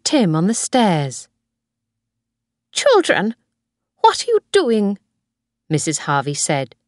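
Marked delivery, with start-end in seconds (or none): 2.7-5.0 high-pitched scolding question; startled grandmother. A narrator's reading. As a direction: challenge the children in a raised pitch. Grandmother challenges children on stairs.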